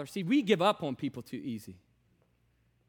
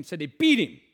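usual speaking voice

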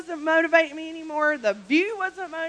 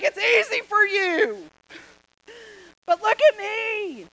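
slow, higher, whining; whiny complaint; 6 semitones higher than usual. Retold or imitated. Tired runner whining at the finished friend. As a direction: complain with a sob in it. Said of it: "You're like".

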